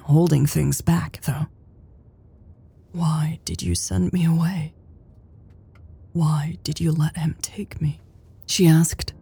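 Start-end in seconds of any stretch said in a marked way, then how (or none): 2.9-8.0 barely above a whisper. A narrator's reading. Louise asks Elle the dreaded question, barely above a whisper. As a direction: ask it very quietly, with pauses. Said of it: "in a voice barely above a whisper".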